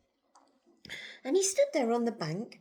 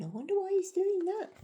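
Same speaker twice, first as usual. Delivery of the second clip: usual speaking voice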